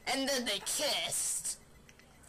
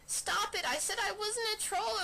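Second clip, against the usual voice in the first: ordinary delivery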